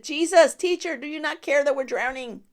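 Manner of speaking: loud, high panicked disciples; frightened cry. Acted. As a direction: shout it like men drowning. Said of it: "they said".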